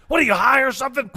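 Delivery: high, fast, shouted taunt; loud mocking shout. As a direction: yell it like a taunt. Movie quote, shouted.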